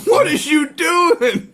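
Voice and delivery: mock shout. Comic outburst at friend.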